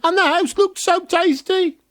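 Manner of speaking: put-on high, fast frightened girl voice; scared little girl. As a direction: blurt it out in a panicky rush. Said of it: "Gretel was scared, but managed to say".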